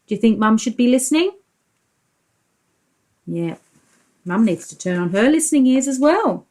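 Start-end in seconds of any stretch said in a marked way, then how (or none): none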